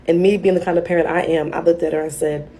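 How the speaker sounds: ordinary talk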